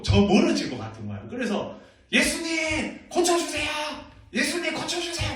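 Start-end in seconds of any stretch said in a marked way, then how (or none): 2.1-5.4 high, loud pleading cry to Jesus; desperate crying out. Acted. Blind men crying after Jesus, loud and high-pitched pleading. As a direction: call out frantically so he hears you. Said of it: "막 소리를 질렀어요".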